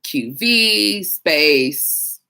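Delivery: very slow, letter by letter; spelling out slowly. Shown as a demonstration. Dispatcher demonstrating query syntax, slow and careful. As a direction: read the code out deliberately. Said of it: "it would be like".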